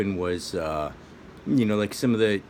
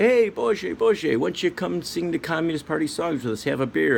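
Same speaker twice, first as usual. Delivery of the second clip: hailing communist comrade; 10 semitones higher, 4 dB louder than usual. Retold or imitated. Communists hailing father, friendly invite.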